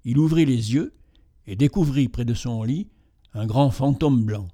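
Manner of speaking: usual speaking voice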